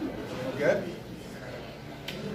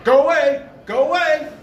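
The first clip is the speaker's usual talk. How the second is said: high, loud warning call; retold yell at the door; shouting him off; 7 semitones higher, 6 dB louder than usual. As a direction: drive off an intruder. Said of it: "He's going".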